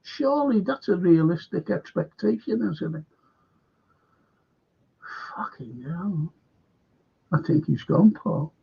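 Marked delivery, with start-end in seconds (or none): 5.3-6.3 under your breath, fed up; exasperated whisper. Real, not acted. Fan muttering at the club's excuses, hushed exasperated whisper. Fan despairing at club talk.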